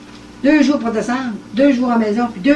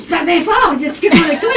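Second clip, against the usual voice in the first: husband calling out; 7 semitones higher, 6 dB louder, faster than usual. Retold or imitated. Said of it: "Il me crie, mon mari".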